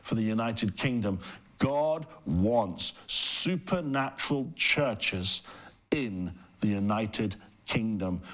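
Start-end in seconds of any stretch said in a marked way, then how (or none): 1.6-8.2 slow, weighty preaching, word by word; emphatic declaration. Real, not acted. Preacher declaring his burning heart for the United Kingdom, emphatic. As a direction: proclaim it slowly, spacing out each phrase.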